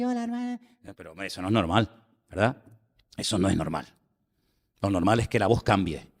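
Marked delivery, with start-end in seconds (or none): 0.0-0.6 put-on higher, softer boyish voice for a grown man; mock a grown man who still talks like a little boy; childish voice. Preacher mocks grown men who talk like children, higher and softer. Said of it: "Hay hombres que hablan así".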